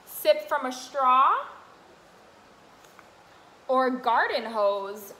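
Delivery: sing-song picture book read; reading to children. Acted. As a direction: read the rhyme brightly to little ones. Continues reading rhyming book.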